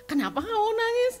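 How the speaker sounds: high, lively quote of the husband. Retold or imitated. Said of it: "Terus dia bilang".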